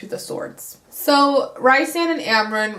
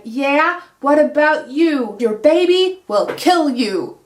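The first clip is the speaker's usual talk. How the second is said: loud, higher mock-cruel comeback; spiteful retort; 4 semitones higher, 6 dB louder than usual. Nesta turning on her sister, loud spiteful retort. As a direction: spit the line back at her sister.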